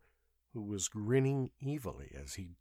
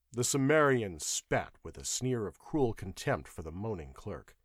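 ordinary delivery

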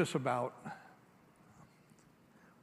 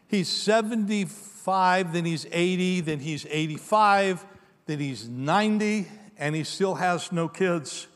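slow build; slower than usual. Real, not acted. Slow count of years.

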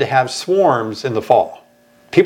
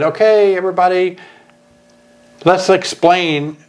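announcing to all; 4 semitones higher than usual. Retold or imitated. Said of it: "That's the one where I said".

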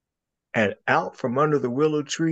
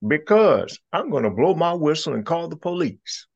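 cheeky rabbit threat; 7 semitones higher, 3 dB louder than usual. Acted.

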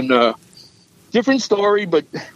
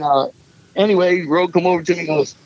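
ordinary delivery

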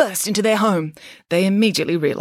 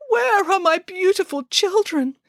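very high, slow lament; tearful wail; 12 semitones higher than usual. A narrator's reading. Mother pig calls for her missing children, tearful wail. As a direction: cry out slowly for lost children. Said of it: "cried the mother pig".

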